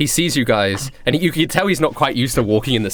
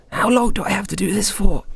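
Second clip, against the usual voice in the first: higher, sulky complaint out of earshot; a hint of a whining captive; whiny Douglas voice; 7 semitones higher than usual. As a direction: mutter it like a fed-up impostor.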